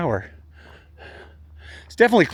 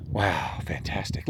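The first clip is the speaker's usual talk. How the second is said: whisper; 10 semitones lower, 11 dB quieter, slower than usual. Real, not acted. Quiet awe at sunset.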